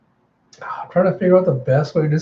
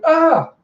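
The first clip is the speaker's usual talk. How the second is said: short high yelp; mock scream of surprise; startled scream; 14 semitones higher, 5 dB louder than usual. Girl grabbed by werewolf.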